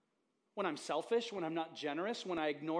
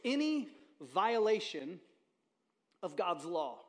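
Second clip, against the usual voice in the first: deliberate preacher's definition; slow emphatic definition; slower than usual. Real, not acted. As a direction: lay it down word by word. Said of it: "by definition, is simply this".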